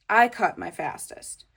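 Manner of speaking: ordinary delivery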